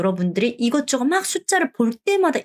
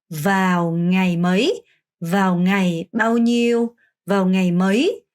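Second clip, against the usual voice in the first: very slow and clear; teacher's careful phrase model; slow demo reading; much slower than usual. Shown as a demonstration. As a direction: model each question slowly. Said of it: "같은 표현이에요".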